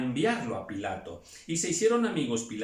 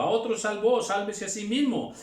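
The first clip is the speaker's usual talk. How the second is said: higher, louder sneering jeer; mocking taunt; 7 semitones higher, 4 dB louder than usual. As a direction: taunt the crucified man. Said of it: "se burlaban de él". (burla de los gobernantes)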